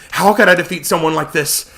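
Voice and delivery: usual speaking voice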